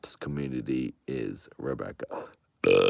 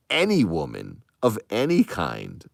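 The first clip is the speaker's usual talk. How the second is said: ordinary talk, nothing marked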